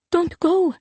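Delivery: high, small frightened plea; child's whimper. A narrator's reading. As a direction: whimper like a scared little girl. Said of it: "Liliane whimpered".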